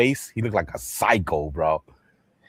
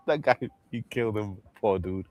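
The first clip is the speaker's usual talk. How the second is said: usual speaking voice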